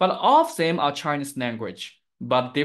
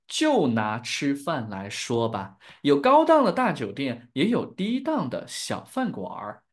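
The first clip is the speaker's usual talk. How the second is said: carefully pronounced standard Mandarin. Shown as a demonstration. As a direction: teach it in clear standard Mandarin. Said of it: "in standard Mandarin".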